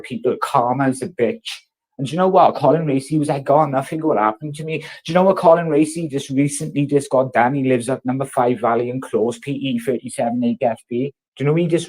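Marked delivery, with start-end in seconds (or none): none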